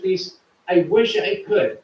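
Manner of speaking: ordinary delivery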